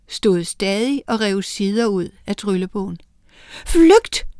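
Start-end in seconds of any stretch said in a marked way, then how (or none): 3.7-4.3 high, loud call to flee; shout. Acted. Miranda shouting a warning to Oline, high and loud. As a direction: yell a warning. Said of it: "råbte Miranda".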